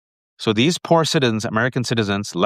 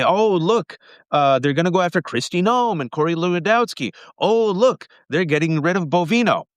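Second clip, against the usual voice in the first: much higher, sing-song oh look; mock naive optimism; 6 semitones higher than usual. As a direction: parody people hoping for change. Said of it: "you heard people say".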